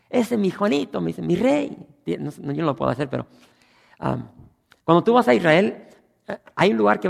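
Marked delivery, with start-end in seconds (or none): 0.1-1.8 high singsong northern Mexican lilt; Baja California singsong. Retold or imitated. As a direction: sing it like a Baja California local. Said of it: "como un sonsonete diferente".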